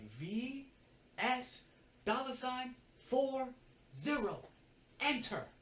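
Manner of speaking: high, slow, item-by-item dictation; pointed spelling-out. Retold or imitated. Assistant showing upset coworker what to type, pointedly.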